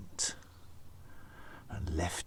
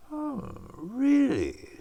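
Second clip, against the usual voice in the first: high slow snake voice; 4 dB louder, slower than usual. Acted. Snake pleased by gifts.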